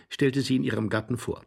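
ordinary delivery

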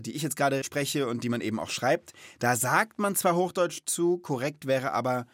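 ordinary talk

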